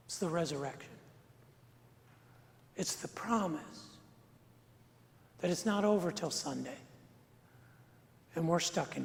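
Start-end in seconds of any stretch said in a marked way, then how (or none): none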